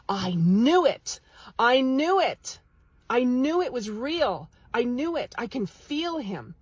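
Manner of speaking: imitating an overjoyed woman, loud and drawn out; excited. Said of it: "she was just so excited".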